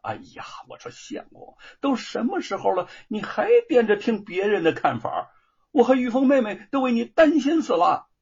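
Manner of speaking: from a hushed start up to a loud, high scolding; exasperated wife. Acted. Wife, exasperated at her scholar husband, scolding loudly. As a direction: scold him, worried sick. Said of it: "叶云真是又气又急".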